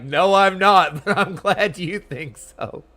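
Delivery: loud, high, drawn-out denial through laughter; laughing, flattered reply; amused protest. Retold or imitated. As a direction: protest slowly, then laugh. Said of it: "I'm like".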